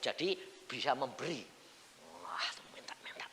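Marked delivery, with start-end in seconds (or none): 2.2-3.2 hushed whisper; a whispered wah; whispered aside. Real, not acted. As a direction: drop to a whisper, confiding. Whispered aside about asking.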